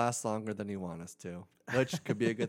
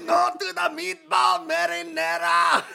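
shouting Italian mobster, much higher; Italian mafioso accent; 9 dB louder than usual. Pretend. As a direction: yell it like a mob guy. Italian mafioso bit continues.

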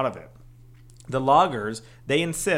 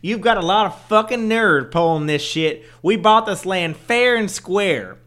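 slow, loud angry Southern foreman; Southern accent; 5 semitones higher, 8 dB louder, slower than usual. Retold or imitated. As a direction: drawl it out like an irate logger. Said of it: "they don't get an accent?".

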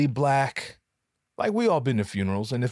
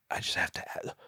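breathy whispered lean-in; 8 dB quieter, faster than usual. Pretend. Asking Randy at the funeral, whispered. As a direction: whisper it close like a secret.

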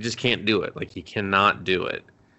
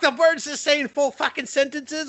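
very high-pitched mock outrage; shocked outburst. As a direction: squeal it in comic disbelief. Acts out own shock at bird.

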